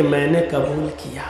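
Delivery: a bride pushed into saying yes, barely audible; forced timid yes. Retold or imitated. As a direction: squeeze the words out like a cornered shy girl. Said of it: "بیچاری کہتی ہے کہ".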